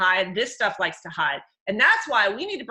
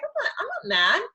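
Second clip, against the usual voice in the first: fake sweet denial; 12 semitones higher than usual. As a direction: deny the anger in an overly sweet voice. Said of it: "He's like".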